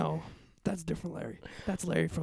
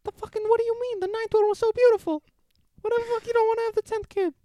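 put-on much higher, girlish husband voice; high-voiced husband arguing with his wife; girly voice; 13 semitones higher, 4 dB louder than usual. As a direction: play the husband way up high like a girl. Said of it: "you have a deeper voice than me".

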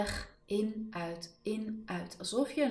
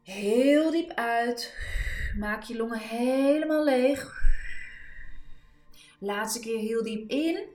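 rousing coach call; 5 semitones higher, 7 dB louder than usual. Real, not acted. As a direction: push them to the limit. Final push, deep breaths.

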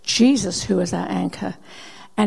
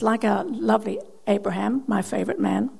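ordinary talk, nothing marked

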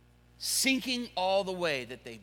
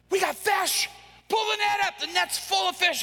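high, shouted excitement; thrilled Peter; 10 semitones higher than usual. Acted. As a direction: yell it in disbelief and joy. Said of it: "he goes".

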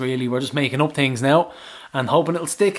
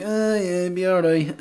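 unimpressed; 5 semitones higher than usual. Retold or imitated. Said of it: "And I was like".

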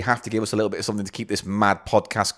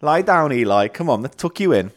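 tucking in at bedtime; 6 semitones higher than usual. Pretend. Tucking friend in like a baby.

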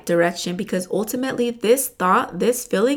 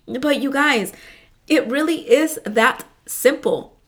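normal talking voice

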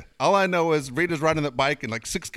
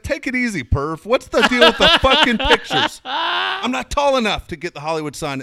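high, loud sarcastic son's voice; mocking angry son; 13 semitones higher, 8 dB louder than usual. Son scolding his mom over the photos, high sarcastic voice. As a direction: sneer at your mom's useless photos.

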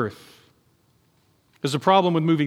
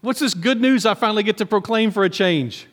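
eager, higher-pitched question; excited Isaiah; 5 semitones higher than usual. Acted. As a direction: sound thrilled it's finally good news. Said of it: "Isaiah's all excited".

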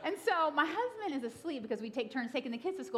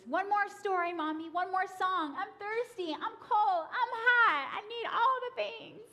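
put-on whiny child; 10 semitones higher than usual. Imitating a child stalling bedtime.

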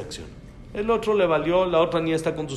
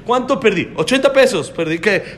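emphatic; 7 semitones higher, 5 dB louder than usual. Real, not acted. Emphatic rhetorical point to class.

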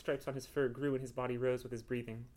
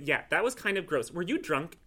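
louder, higher acted reproach; mild scolding; 4 semitones higher, 7 dB louder than usual. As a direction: scold the cat for it. Woman scolding cat over mess.

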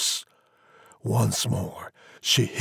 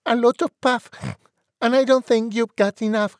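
high, loud, squeaky pig taunt; cheeky piglet talking back; piggy voice; 13 semitones higher, 5 dB louder, faster than usual. A narrator's reading. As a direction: squeak it out defiantly. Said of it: "pig said".

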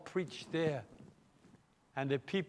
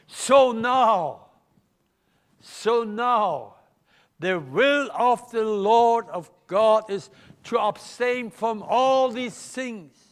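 louder, higher pulpit emphasis; forceful preaching; 5 semitones higher, 8 dB louder, slower than usual. Real, not acted. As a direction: drive the point home with force. Preacher builds to forceful point.

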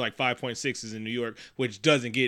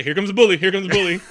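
mock announcement; 5 semitones higher, 7 dB louder, faster than usual. Calling out an approaching film bully.

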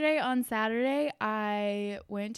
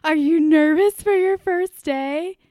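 higher, louder, slow sing-song question; reporter to a child; 4 semitones higher, 12 dB louder, slower than usual. Retold or imitated. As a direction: coax a kindergartner gently but brightly. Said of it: "she was like".

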